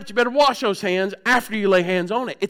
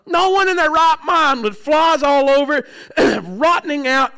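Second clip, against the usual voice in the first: emphatic preaching; 5 semitones higher, 4 dB louder than usual. Real, not acted.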